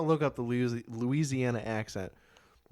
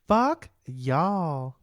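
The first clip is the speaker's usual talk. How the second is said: loud, higher Southern twang; sweetly caring Southern insult; 5 semitones higher, 9 dB louder than usual. Retold or imitated. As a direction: insult them as kindly as a Southerner can. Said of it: "the nicest, most caring way possible".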